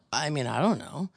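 normal talking voice

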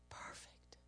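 breathy whisper. Real, not acted. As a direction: breathe the word out. Whispered longing for home.

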